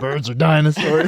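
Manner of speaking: high, loud goofy uncle. Retold or imitated. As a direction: play the goofy uncle announcing it. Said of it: "uncle comes out of the garage and he's like".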